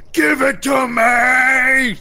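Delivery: high, drawn-out full shout; yelling orc. Acted. Orc demands the pickaxe from the audience, yelling. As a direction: bellow the demand, stretching the words out. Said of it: "starts yelling".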